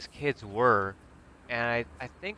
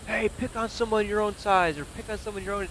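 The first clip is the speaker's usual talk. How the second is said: high, mildly raised heckle; retold screaming; 10 semitones higher than usual. Imitating bikers defending him from the bar, mock scream. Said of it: "and they're screaming".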